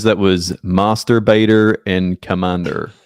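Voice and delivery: slow, deliberate announcer delivery; radio announcer. Acted. Co-host announces the film title for everybody, radio announcer voice.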